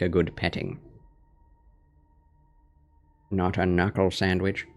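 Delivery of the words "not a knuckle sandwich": normal talking voice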